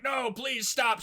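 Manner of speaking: hammed-up pleading mayor; mock plea.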